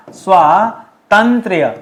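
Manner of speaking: स्वातंत्र drawn out slowly, long आ after स्व; slow model word. Shown as a demonstration. Teacher showing students how to say स्वातंत्र, slowly. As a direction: stretch स्वातंत्र so the long आ is clear. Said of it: "ऐसे लिखेगा".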